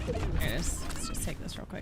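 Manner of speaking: whispered aside. Real, not acted. Streamer whispering to herself while grabbing the card. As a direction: mutter it under breath.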